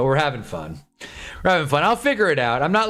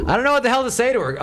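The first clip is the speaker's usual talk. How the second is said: fast, higher and flustered; 10 semitones higher, faster than usual. Real, not acted. Streamer to chat, rattled about his date, fast flustered blurt. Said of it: "out of sorts right now".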